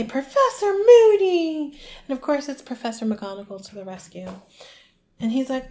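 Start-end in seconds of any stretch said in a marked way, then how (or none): 0.0-1.8 high, loud, drawn-out cry of shock. Retold or imitated. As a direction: imitate a scandalised teacher calling out. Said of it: "a shocked voice say".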